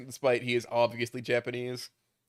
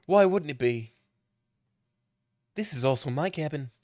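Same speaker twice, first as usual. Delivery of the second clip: ordinary delivery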